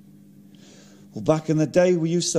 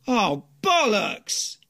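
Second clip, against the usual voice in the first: drawn-out exasperation; 5 semitones higher, much slower than usual. Acted. Forgot passport.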